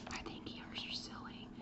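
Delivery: whispered, playing the careless student; mock student whisper. Teacher voicing a student who skips ears, in a whisper. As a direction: breathe it like a kid shrugging off ears.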